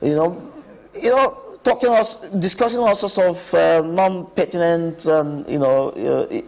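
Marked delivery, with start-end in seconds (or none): none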